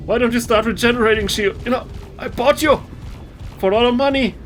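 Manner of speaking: high-pitched fake Indian accent; faux Indian accent. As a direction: plead with your gear in a put-on, high Indian accent. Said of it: "faux Indian voice".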